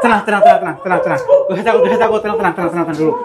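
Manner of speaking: startled yelp into nervous laughter; nervous laughing. Real, not acted. Spotting the figure again, nervous laughing reassurance. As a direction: laugh off the fright while calming her.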